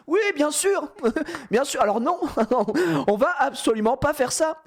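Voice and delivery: high, giggling mock agreement; laughing sarcasm. Friends laughing at the security guard, sarcastic giggling refusal. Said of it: "mais qu'est-ce qu'on rit".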